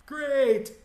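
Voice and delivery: high, loud sarcastic cry; exasperated outburst. Retold or imitated. Employee sure he's about to get fired, loud sarcastic exasperation. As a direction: burst out in mock celebration, pitch way up.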